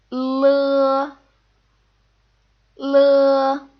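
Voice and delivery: L sounded as the l in lean; Mandarin initial l. Shown as a demonstration. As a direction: say L like the l of lean, clearly. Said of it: "sounds like the L sound in lean".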